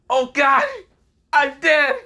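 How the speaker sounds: high, loud hammed-up wail; mock terror. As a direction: squeal in fake doom. Realizes she is a devil.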